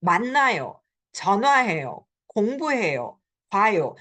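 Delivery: 만나요, 전화해요, 공부해요, 봐요 stressed early, each falling; bossy commands. Shown as a demonstration. Teacher lists commands, bossy falling 만나요, 전화해요, 공부해요, 봐요. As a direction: order 만나요, 전화해요, 공부해요, 봐요 with first-syllable emphasis. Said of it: "this sounds very bossy".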